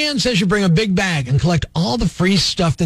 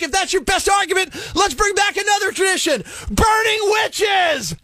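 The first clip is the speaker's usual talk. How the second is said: mock-furious rant; building rant shout; 9 semitones higher than usual. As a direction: build the sarcasm to a yelling peak. Rant climax about tradition.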